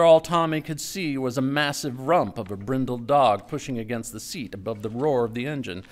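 plain reading voice

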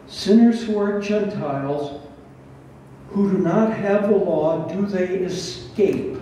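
usual speaking voice

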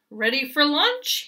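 goat calls loudly from the barrel; calling out. Acted. Goat calls to the hen before the launch, loud. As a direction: shout it out to the hen. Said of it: "he called".

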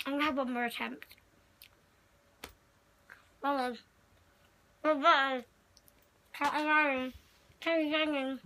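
talking through stuffed cheeks; mouth full. Real, not acted. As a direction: mumble round a mouthful of marshmallows.

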